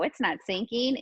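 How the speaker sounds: ordinary talk, nothing marked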